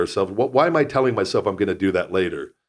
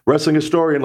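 calling out; 8 semitones higher, 4 dB louder than usual. Acted. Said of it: "calling out to a guest".

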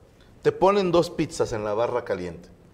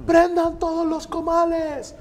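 high holler with drawn-out words; calling out an order; 14 semitones higher, slower than usual. Retold or imitated. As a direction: stretch the command out across the kitchen. Calls out the order to the kitchen. (orden gritada y alargada)

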